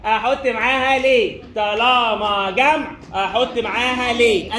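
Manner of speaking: drawn-out teaching chant; slow drilled rule. Shown as a demonstration. Teacher drilling the plural rule into students, slow and drawn-out. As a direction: stretch each phrase slowly for the class.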